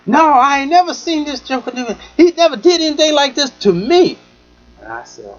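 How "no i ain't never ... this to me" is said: high, emphatic denial; higher voice of the friend insisting; imitating her. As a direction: insist hard, high and loud. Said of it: "she goes".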